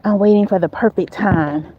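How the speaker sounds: ordinary delivery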